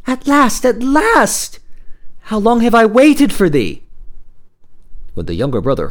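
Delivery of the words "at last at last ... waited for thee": high, loud cry of relief; joyful calling out. Acted. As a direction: call down to your long-awaited husband, high and loud. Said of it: "she called to him".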